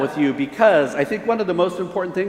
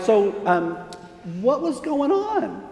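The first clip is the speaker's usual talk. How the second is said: hesitant parent; 7 semitones higher, slower than usual. Retold or imitated. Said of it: "And we're like".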